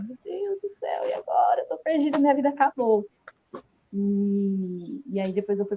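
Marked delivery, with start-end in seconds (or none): 0.0-3.1 high-pitched retold despair; imitated tearful panic. Daughter hearing the diagnosis from her mother, imitated tearful panic. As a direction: lament it like a mock sob. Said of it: "eu comecei a chorar".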